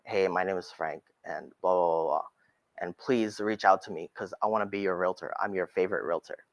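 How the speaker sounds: low, soft monotone self-introduction; boring tone. Shown as a demonstration. Realtor introducing himself to viewers in a dull low drone. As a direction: drone through it like you're bored. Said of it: "like a boring tone".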